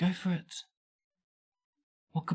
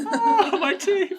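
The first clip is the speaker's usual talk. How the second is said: high squeal through laughter; laughing shriek; 10 dB louder, much slower than usual. Acted. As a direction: cry out while laughing. Acted laughing exclamation.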